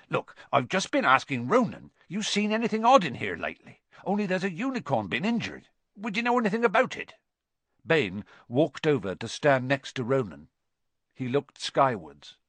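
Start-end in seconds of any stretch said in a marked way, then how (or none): 0.1-7.1 fast, high, questioning character voice; quick, eager inquiry in character; Hagrid. A narrator's reading. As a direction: hurry through the questions, pitch lifting. Hagrid questions Bane about the unicorn.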